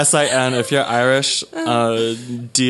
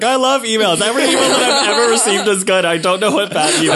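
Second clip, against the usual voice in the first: gushing imitation; 6 semitones higher than usual. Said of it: "you're gonna be like".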